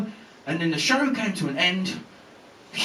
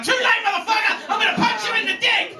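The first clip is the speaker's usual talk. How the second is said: high-pitched gloating yell; spring the trap with a high, mocking shout; triumphant shout. Salesman turning on the tricked homeowner, triumphant mock shout.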